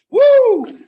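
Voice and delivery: loud high whoop; hype whoop. Real, not acted. Cohost firing up livestream viewers with a loud whoop. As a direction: whoop to pump up the crowd.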